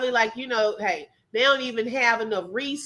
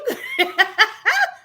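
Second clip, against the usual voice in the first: high laughing burst; burst of laughter; 8 semitones higher than usual. Real, not acted. Amused at the escape news, high burst of laughter.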